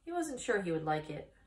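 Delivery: ordinary delivery, nothing marked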